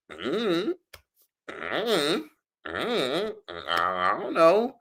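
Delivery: drawn-out high squeaky hums, then a high "I don't know"; stumped hemming. Retold or imitated. Woman recalling having nothing to say, stumped hemming. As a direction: hem and haw in a high, noncommittal voice. Mimes her stumped reply.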